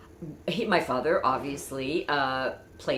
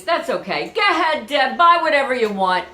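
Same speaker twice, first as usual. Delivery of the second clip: higher, louder big-spender call; expansive dad; 7 semitones higher, 8 dB louder than usual. Retold or imitated. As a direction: wave off the closing time grandly. Father tells shopkeeper, daughter.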